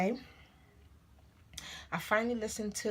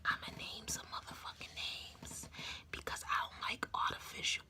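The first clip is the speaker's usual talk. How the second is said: hushed, much softer whisper; 4 semitones higher, 12 dB quieter than usual. Real, not acted. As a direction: drop to a conspiratorial whisper. Hushed threat to name names.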